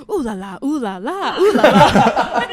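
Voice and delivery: mock French, building to a loud, slow, laughing ooh la la; French commentator. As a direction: ham up a French sports commentator. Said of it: "they showed the French one".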